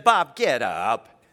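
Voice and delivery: a hint of a wife thinking he's playing; wife calling husband. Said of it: "she literally said".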